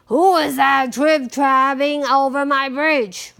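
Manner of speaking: loud troll challenge; troll's roar. A narrator's reading. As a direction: roar at whoever is on the bridge. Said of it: "roared the troll".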